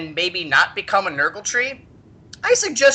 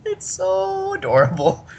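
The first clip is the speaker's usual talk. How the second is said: gushing; 12 semitones higher, slower than usual. Real, not acted. Gushing over cute nurgling.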